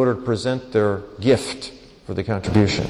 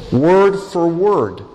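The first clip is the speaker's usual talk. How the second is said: Word for word, each word slow and loud and a touch higher; slow emphatic stress; 4 semitones higher, 4 dB louder, much slower than usual. Real, not acted. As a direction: press every word apart, slowly. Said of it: "saying they did exactly as they were told".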